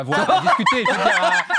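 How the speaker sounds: high, loud, laughing backtrack; embarrassed slip dissolving into laughter; flustered laughing. Real, not acted. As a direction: burst out laughing while correcting yourself. Flustered laughing over his slip.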